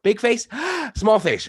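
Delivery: high gasp between the words; big face gasp drill. Shown as a demonstration. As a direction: gasp wide, then shrink to small face. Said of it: "we go back and forth".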